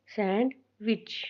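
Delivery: Sandwich as sand, pause, विच, each short; two slow, separate beats in sandwich; short vowels, split syllables. Shown as a demonstration. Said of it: "छोटी sound आती है".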